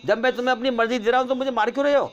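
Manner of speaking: high, loud desperate begging; pleading cry. Acted. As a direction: beg for your life, voice climbing high. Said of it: "رونے لگا پھر". (گڑگڑاتی ہوئی اونچی فریاد)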